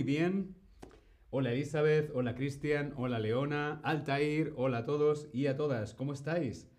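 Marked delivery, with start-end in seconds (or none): none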